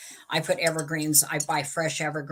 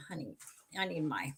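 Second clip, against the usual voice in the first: whispered aside; breathy whispered request; 5 dB quieter than usual. Real, not acted. Asks husband quietly.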